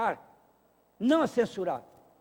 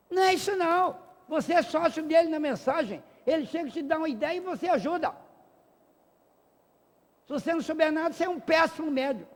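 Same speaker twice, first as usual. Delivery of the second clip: higher and louder, hammering rebuke; scolding; 9 semitones higher, 4 dB louder than usual. Real, not acted. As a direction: tell them off from the pulpit. Scolding careless mediums. (bronca alta e aguda)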